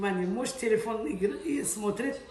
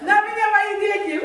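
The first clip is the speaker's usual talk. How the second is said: shouting; 7 semitones higher, 7 dB louder than usual. Real, not acted. Sudden shout.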